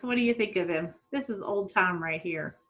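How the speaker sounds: ordinary delivery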